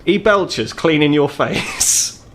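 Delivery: usual speaking voice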